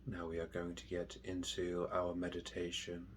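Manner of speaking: hushed, unhurried guidance, letting each phrase settle; guided meditation. Real, not acted. Meditation teacher guiding listeners through mindful breathing, slow and soft.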